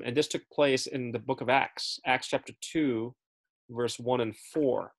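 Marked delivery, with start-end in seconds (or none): none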